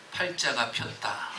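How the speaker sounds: slow, low, soft saying; folksy proverb said low and slow; slow quip. Retold or imitated. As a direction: drop the saying slowly and softly. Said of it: "이렇게 이야기하는 거예요".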